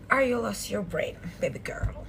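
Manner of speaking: unvoiced taunting whisper.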